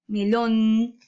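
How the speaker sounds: melón with a held, stressed LÓN; weight and hold the lón of melón; stress on last syllable. Shown as a demonstration. Teacher demonstrating the tonic syllable, melón stressed on LÓN. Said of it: "En lon, porque hay mayor fuerza".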